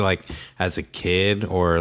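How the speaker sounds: ordinary delivery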